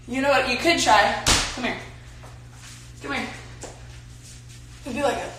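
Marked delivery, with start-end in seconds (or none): none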